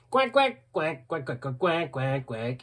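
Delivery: lightly mimicked duck quacks, fading out; duck quacking. Showing his duck Shaki to viewers, a playful imitated quack. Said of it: "Ik ben Shaki".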